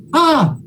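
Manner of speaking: a high, open "Ah" breathed out like the first letter; breathy aleph sound. Shown as a demonstration. Teacher demonstrating the aleph to the class: high, open breathed "Ah". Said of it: "Moisés lo escuchó".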